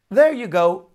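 teasing cry; cheerful cry. A narrator's reading. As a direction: shout it playfully across the water. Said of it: "he cried".

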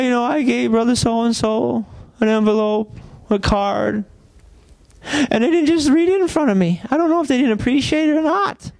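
high, mocking whiny complainer; mock the petty hurt feelings of a churchgoer; lightly voiced whiny church member; whiny offended member.

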